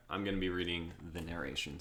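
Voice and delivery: normal talking voice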